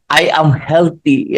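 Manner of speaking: slow, deliberate "I am healthy"; a slow, heartfelt self-affirmation. Shown as a demonstration. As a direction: draw the affirmation out slowly. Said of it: "ilalagyan mo ng emotion".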